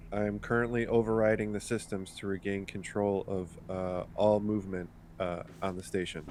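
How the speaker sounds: Crash in character. Acted. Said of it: "Crash would say, uh".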